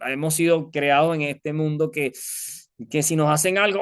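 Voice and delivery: normal talking voice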